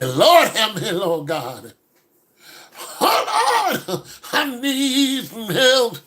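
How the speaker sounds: high, slow, pleading cry to the Lord; pleading prayer. Retold or imitated. A churchgoer caught looking at women, praying high and slow. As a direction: beg the Lord for help, half-joking. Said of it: "I like".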